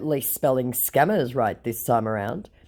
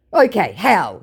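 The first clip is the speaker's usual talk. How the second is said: mock scammer voice; 5 semitones higher, 6 dB louder than usual.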